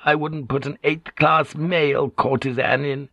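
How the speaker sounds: put-on woman's voice, full of grievance; aggrieved Kyoko. Kyoko complaining to the lady, aggrieved and plaintive.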